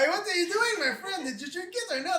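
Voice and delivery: put-on high-pitched accented protest; baffled, put-out accented drinker; indignant tavern gambler. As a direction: demand an explanation like an offended barfly. Gambler indignant at opponent's trick.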